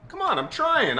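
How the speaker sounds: high-pitched, defensive plea; exasperated protest. Acted. Actor defending his accent, exasperated high protest.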